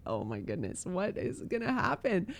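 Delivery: soft, breathy-free hushed worry under the breath; hushed dismay. Retold or imitated. Pastor to himself, dreading the unplanned service, hushed dismay. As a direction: let the alarm slip out quietly, almost to yourself. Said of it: "And I'm like".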